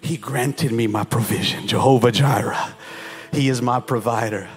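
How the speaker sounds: soft prayerful sermon under music; hushed worship. Acted. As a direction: draw them in with a hush. Hushed confession.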